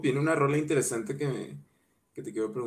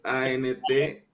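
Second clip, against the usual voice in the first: slow, letter by letter; over enunciating; much slower than usual. Shown as a demonstration. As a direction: spell each letter out clearly. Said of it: "yo lo deletreo". (deletreando despacio, letra por letra)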